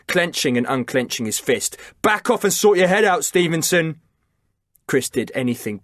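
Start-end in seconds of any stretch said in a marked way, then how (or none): 2.0-4.0 higher, louder firm command; shouted order. A narrator's reading. Liam warning Chris to calm down, firm shout. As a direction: hold the line with a firm shout.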